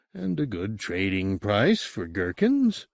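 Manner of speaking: plain reading voice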